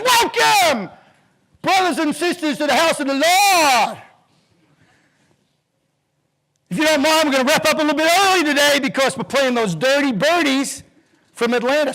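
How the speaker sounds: high, loud, fired-up preacher greeting; a Southern pastor welcoming his flock; booming preacher. Retold or imitated. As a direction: throw it to the back pew like a pastor. Pastor greets congregation, retold.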